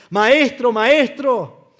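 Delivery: high, loud pleading call; urgent cry. Acted. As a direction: call out in panic. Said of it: "ellos dicen". (llamado fuerte y desesperado)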